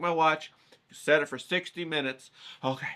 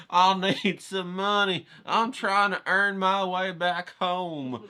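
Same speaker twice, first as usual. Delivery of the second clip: higher, slow, hammed-up plea for money; mock pleading beggar; 7 semitones higher, 4 dB louder, slower than usual. Pretending to beg passersby for cash, mock pleading. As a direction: drag it out like a pitiful street beggar.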